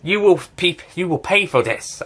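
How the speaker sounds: half-serious villain vowing revenge; acted villain quote. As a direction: proclaim it as a lightly hammed-up threat. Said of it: "this guy's just like".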